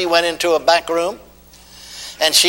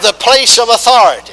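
preaching call; 6 semitones higher, 7 dB louder than usual. Acted. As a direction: chant it out line by line. Call and response.

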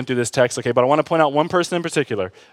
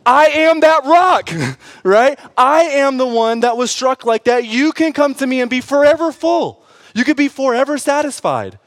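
Jesus proclaiming; 9 semitones higher, 5 dB louder than usual. Acted. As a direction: boom it out as Jesus declaring himself. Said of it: "Jesus says".

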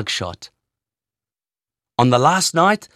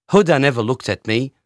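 ordinary delivery, nothing marked

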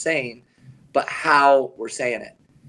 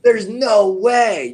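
drawn-out, higher, louder imitated disbelief; mock disbelief; 7 semitones higher, 5 dB louder, much slower than usual. Retelling coach's shock at the count, mock disbelief. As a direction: stretch it out like a stunned coach.

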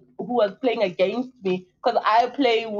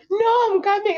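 upset daughter; 8 semitones higher than usual. Retold or imitated. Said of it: "She was so upset".

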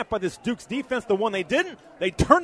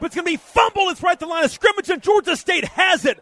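excited; 5 semitones higher, 4 dB louder than usual. Real, not acted. Fumble called live.

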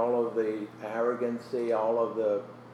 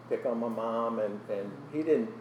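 ordinary talk, nothing marked

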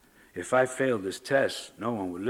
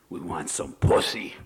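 gruff voice; 6 semitones lower than usual. Acted. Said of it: "a gruff voice said".

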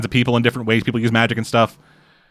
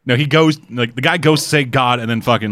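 normal talking voice

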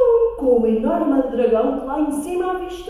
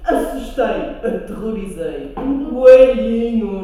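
boastful prince; 2 semitones lower, 7 dB louder than usual. Acted. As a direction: brag grandly about nothing.

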